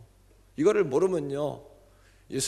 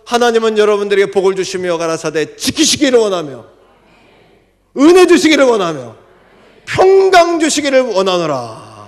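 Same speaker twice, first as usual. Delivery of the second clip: preaching at full force; 6 semitones higher, 14 dB louder than usual. Acted. Preacher proclaiming a scripture blessing, full force. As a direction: pronounce the blessing over them.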